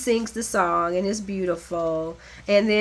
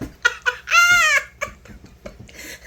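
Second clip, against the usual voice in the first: shrieking laugh; 5 dB louder than usual. Real, not acted. Laughing at foot stomp.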